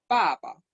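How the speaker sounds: "papa" with the first syllable falling sharply from high; fourth tone. Shown as a demonstration. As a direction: drop hard on the first "pa", short light second. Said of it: "se dice en cuarto tono la primera sílaba".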